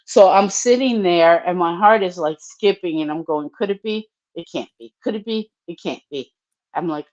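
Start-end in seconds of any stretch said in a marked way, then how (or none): 3.6-6.3 anxious murmur. Retold or imitated. Waiting for the parole officer, soft worried murmur. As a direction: mutter it to yourself, half in disbelief.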